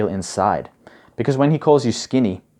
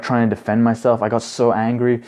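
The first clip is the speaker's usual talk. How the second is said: normal talking voice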